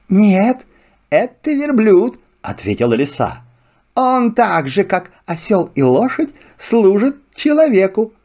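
high, lively fox. Acted. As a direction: chatter like a sly fox. Fox speaks. (голос лисы)

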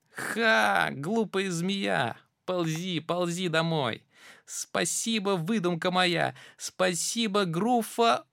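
high squeaky mouse voice, cheeky; gloating little mouse. Acted. Mouse mocking the snake, high and cheeky. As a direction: crow over the fooled snake.

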